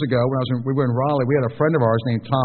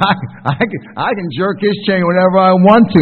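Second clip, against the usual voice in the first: louder, cocky imitated boast; Nikki, gleeful confidence; 5 semitones higher, 6 dB louder than usual. Wife bragging about provoking her husband, imitated glee. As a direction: crow it like someone sure of her power. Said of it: "such confidence and such almost glee".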